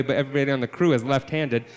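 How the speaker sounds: ordinary talk, nothing marked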